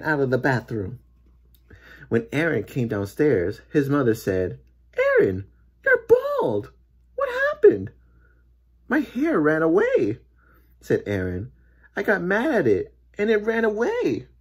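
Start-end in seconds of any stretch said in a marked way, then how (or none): 5.0-7.9 high, loud cry of alarm; shocked mother. A narrator's reading. Mother shocked at bald son. 8.9-10.2 put-on raised-pitch boy explaining himself; upset boy. A narrator's reading. 12.0-14.3 put-on raised-pitch boy explaining himself; upset boy. A narrator's reading.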